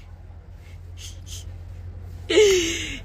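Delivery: wheezing laugh up high; helpless giggling wheeze; high breathy laughing fit. Real, not acted. Laughing fit, repeated bit.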